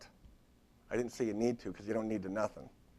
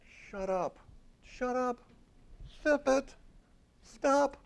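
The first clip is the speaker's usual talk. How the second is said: mock scolding; 13 semitones higher, slower than usual. Scolding the imagined therapist.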